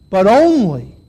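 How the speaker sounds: "But only", proclaimed, then a pause; stress on ONLY. Acted. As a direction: land hard on "only". Repeats quote, stressing only.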